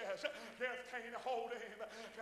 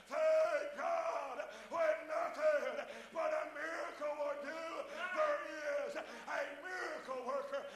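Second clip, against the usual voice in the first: loud, higher, stretched shouting; preaching at full force; 3 semitones higher, 6 dB louder than usual. Acted. Preacher exhorting the church, full shout. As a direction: exhort them with everything.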